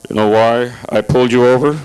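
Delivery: smug patrolman question; traffic cop voice. Retold or imitated. Traffic cop swaggering up to the car, low smug voice. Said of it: "Guy comes swaggering up".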